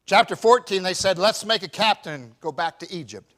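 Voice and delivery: loud, high preaching call; preacher booming out the text; full-force preaching. Acted. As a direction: lift the whole church with it.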